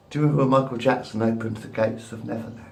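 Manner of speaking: normal talking voice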